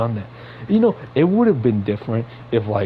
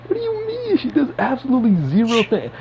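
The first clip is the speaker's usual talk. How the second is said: exasperated, voice leaping up; incredulous outburst; 11 semitones higher than usual. Real, not acted. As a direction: pitch jumps high in disbelief. Exasperated rant about MJ.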